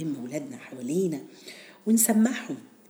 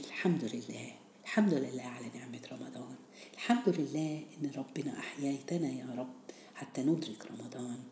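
quiet prayer; 3 semitones lower, 11 dB quieter than usual. Acted. Closing prayer of thanks.

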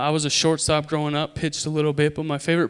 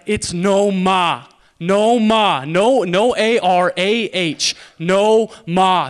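loud, drawn-out Boston "NO-MAH", R dropped; broad Boston accent, raised voice; 4 semitones higher, 7 dB louder, much slower than usual. Acted. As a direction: correct them in slow, emphatic Boston speech. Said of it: "That's how we say it in Boston".

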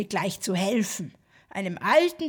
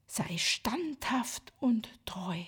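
slow, soft whisper; gentle whisper; 7 dB quieter, slower than usual. Acted. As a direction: breathe it tenderly and slowly. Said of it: "sprach mit sanfter Stimme".

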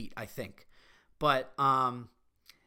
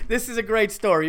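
laughing; 4 semitones higher, 4 dB louder than usual. Real, not acted. Host laughs before a story.

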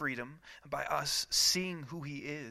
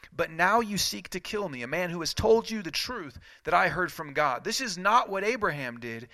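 higher, louder, building accusation; intense preaching; 3 semitones higher, 4 dB louder than usual. Acted. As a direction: press the charge harder each line. Jesus' words, rising intensity.

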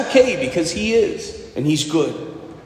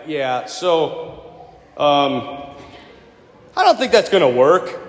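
reluctant Moses; 5 semitones lower, slower than usual. Retold or imitated. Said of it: "I'm like".

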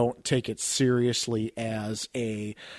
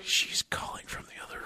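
hushed whisper so as not to wake her; 8 dB quieter than usual. Real, not acted. Not waking sleeping wife.

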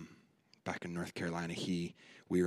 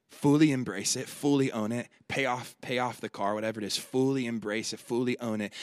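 loud, high preacher's cry; preaching at full force; 4 semitones higher, 8 dB louder than usual. Acted. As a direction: push it out to the whole room. Preaching build.

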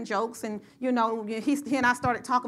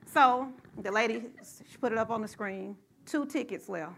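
ordinary talk

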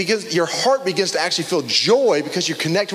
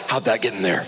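lower, puzzled mumble to yourself; puzzled reader; 10 semitones lower than usual. Retold or imitated. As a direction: wonder aloud at a familiar verse, voice dropped low. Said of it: "it's like".

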